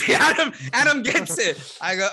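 real laughter spilling through the words; laughing. Real, not acted. Cracking up as a cohost gets the joke, laughing.

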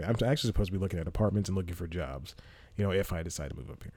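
normal talking voice

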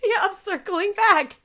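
chirpy corporate voice, pitched high. Pretend. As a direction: echo it as a bright office phrase. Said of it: "echo of the email line".

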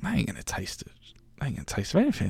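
hushed inner voice, sceptical; whispering to himself about the bland-looking food; whispered thought. Retold or imitated. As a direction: whisper it like a private doubt.

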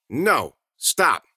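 firm refusal. A narrator's reading. Milo refusing to follow Susie.

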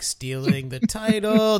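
high, drawn-out mock sneakiness; mock scheming. Teasing about taking the co-host's title, hammed-up scheming. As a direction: stretch it out like a playful confession. Acted retelling of stealing the title.

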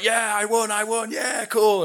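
high, cocky mock victory chant; imitating the leery bloke claiming he won; gloating drunk. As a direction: crow about a win he didn't get. Drunk guy falsely claims win.

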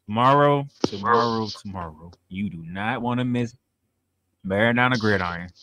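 very low, softened promo voice; announcer character voice. Pretend. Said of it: "You gotta say it like the character".

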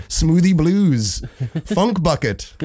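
high, drawn-out mock song announcement; hammed-up reveal of embarrassing song titles; mock announcer. Mockingly announces old band's song names.

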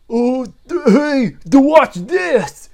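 high, loud, stammering show-off; hey watch this, loud and high; excited brute henchman. Acted. Henchman shows off before smashing the ball, loud high voice.

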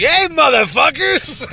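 high, slow, loud rowdy yell; drunken greeting shout. Pretend. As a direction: bellow it like a hungover uncle crashing a party. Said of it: "when I'm like".

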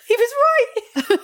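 high, drawn-out laughing cry; mock alarmed realisation. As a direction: squeal it slowly through laughter. Acting out her alarmed realisation.

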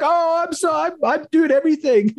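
high-pitched boastful Stan Lee impression; squeaky, bragging old-timer; mock Stan Lee. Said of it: "He's like".

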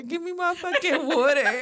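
a stoned friend whining for water back; whiny high plea. Retold or imitated. Roommate took her water, she whines high and laughing. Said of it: "And I'm like".

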